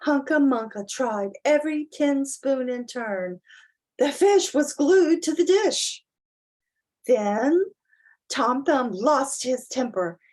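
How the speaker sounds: put-on expressive read-aloud to children; dramatic children's story narration; storybook reading. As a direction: read it like bedtime to little ones. Reads picture book aloud.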